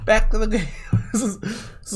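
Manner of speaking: laughs at himself and gets back to gaming; laughing. Real, not acted. Laughing while speaking.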